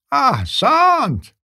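high, slow triumphant shout. Acted. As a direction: crow it out in triumph. Old man exclaims in triumph.

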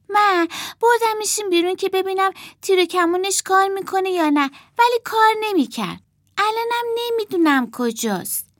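put-on very high small child's voice; little sister excusing herself. A narrator's reading. Martha explains losing the boy puppet, childish excuse.

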